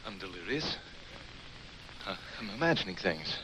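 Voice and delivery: understated, giving in; subdued concession. Acted. Doctor giving in to Rackham's claims, subdued. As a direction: recite their claims back, pitch dropped after the outburst. Gives in, echoing the others' claims.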